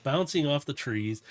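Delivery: normal talking voice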